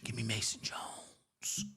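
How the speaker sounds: hushed, breathy whisper of the pick. Acted. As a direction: lean in and whisper the name.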